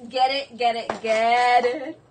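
ordinary talk, nothing marked

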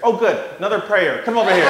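high, loud pushy woman's voice; mock eager old lady. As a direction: chase him down with it. Old lady recruits pastor.

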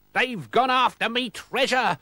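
high, slow wailing pirate. A narrator's reading. Captain fears for his treasure, high slow wail. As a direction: wail it out in character.

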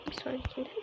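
hushed whisper so it won't hear. Real, not acted. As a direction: whisper under your breath while hiding. Hiding from the monster. (fısıltıyla)

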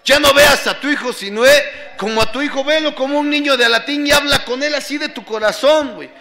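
loud, high, urgent lecture; acting out a friend's tough-love telling-off; retold scolding. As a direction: drive the advice home forcefully. Voices friend scolding him by phone.

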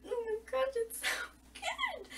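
high-pitched gushing squeal, climbing on good; excited squeal. Real, not acted. Fan gushing to viewers about the book, in a high squeal.

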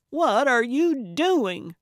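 loud, high, drawn-out demand; startled cry. A narrator's reading. Gertrude startled by Calvin in the dark, crying out. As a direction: cry out in indignant shock. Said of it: "she cried".

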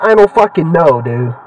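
ordinary delivery

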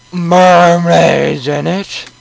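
high, loud, very drawn-out; hammed-up excitement. Guest asks about the swamp, hammed-up excitement. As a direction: squeal it out slowly in exaggerated wonder. Acted excited question after a gasp.

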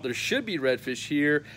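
ordinary delivery

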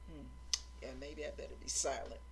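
low, soft, quick concession; humble. Acted. Humbled believer giving in to God, quiet low mumble. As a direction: back down quietly, almost to yourself. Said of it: "be humble enough to say".

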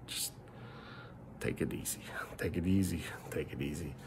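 soft whisper, easing off; hushed calming. Real, not acted. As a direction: quietly settle him down. Calming a troll down.

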